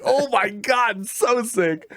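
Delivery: high, stretched-out fanboy gushing; awed excitement. Retold or imitated. Player still gushing over the goblin smackdown, awestruck. Retelling awe at brutal boss.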